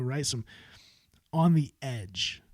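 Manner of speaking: ordinary talk, nothing marked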